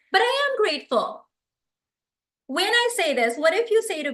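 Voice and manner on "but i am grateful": defensive reply. Shown as a demonstration. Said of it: "the response is".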